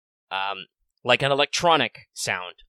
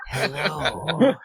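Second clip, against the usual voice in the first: hissing reptile greeting with a laugh; sly lizard host, amused; lizard man voice; 3 semitones higher than usual. Acted. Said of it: "I'm doing kind of a lizard man voice".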